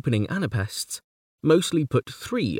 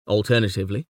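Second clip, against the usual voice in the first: normal talking voice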